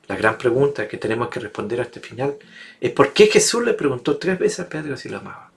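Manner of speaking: normal talking voice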